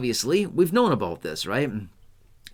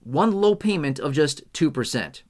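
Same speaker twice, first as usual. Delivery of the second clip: infomercial voice; 7 semitones higher than usual. Pretend. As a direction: sell it like a TV infomercial. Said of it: "Do I sound like an infomercial yet?".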